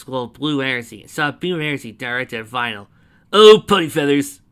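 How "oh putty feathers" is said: loud, raised cry of dismay; cursing. A narrator's reading. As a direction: curse out loud at looming trouble. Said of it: "Vinyl cursed".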